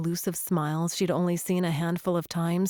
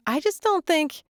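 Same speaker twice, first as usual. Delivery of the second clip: much higher, rising protest; earnest protest cut off; 10 semitones higher than usual. A narrator's reading. Claire arguing with Liam before the phone rings, high. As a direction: start protesting, then stop short.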